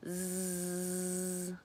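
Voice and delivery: "zzz" as a long steady buzz, voiced z held; buzzing z sound. Shown as a demonstration. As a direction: hold a buzzing "zzz" like a bee. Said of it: "Can you feel your mouth buzz".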